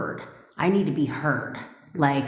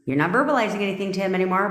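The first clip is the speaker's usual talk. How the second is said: ordinary delivery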